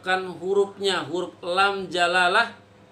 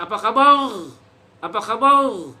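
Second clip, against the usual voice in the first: Apa kabar with a guttural r, louder; lisping speaker; 3 semitones higher, 5 dB louder than usual. Shown as a demonstration. Teacher imitating a lisping person greeting, loud. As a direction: imitate someone who cannot roll r. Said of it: "orang-orang yang cedal".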